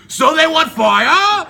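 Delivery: slow, loud, high outraged sneer; Zeus seething as he grabs a thunderbolt; raging Zeus. Acted. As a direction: spit the question out in fury. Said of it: "Zeus was full of rage".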